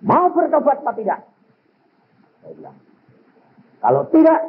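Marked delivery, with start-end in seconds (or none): 0.0-1.2 scolding. Retold or imitated. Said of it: "Saya marahin luar biasa". 3.8-4.4 scolding. Retold or imitated. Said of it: "Saya marahin luar biasa".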